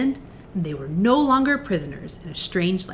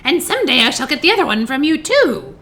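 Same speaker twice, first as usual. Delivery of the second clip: loud, raised witch's taunt; mocking witch; 5 semitones higher, 6 dB louder than usual. A narrator's reading. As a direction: gloat at her in a witch voice. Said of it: "laughing at her".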